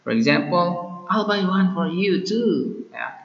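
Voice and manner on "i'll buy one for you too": lightly acted, higher-pitched sudden offer; mock impulsive offer in a raised voice; spontaneous offer. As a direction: pipe up as if just deciding. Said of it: "say spontan, spontaneous".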